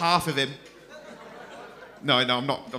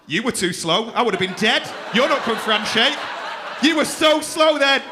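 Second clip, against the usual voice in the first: loud comic outrage, rising higher; indignant scolding; 4 semitones higher, 4 dB louder than usual. Real, not acted. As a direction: shout a half-joking complaint, getting more worked up. Berates someone for slow rescue.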